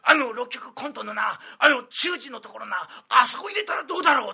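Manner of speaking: put-on impassioned old master. Master pitching idea passionately.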